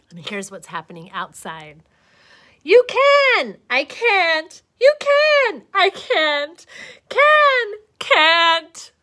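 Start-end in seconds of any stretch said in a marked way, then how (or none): 2.6-8.9 high, loud back-and-forth yelling; shouting match. Acted. Caterpillar yells both sides of his argument. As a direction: argue both sides at the top of your lungs.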